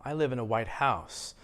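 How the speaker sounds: "house" as the stressed noun. Shown as a demonstration. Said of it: "if I stress the word house a little stronger".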